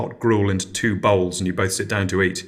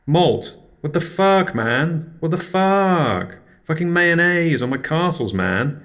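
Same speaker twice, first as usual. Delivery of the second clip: outraged stoner; 8 semitones higher, slower than usual. Acted. Angry neighbour confronts.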